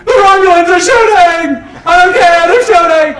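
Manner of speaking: high, very loud comic shouting; Captain Kirk as sitcom character. Acted. Captain under attack on the bridge, high-pitched shouting. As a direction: yell it in hammed-up alarm. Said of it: "Captain Kirk sitting there going".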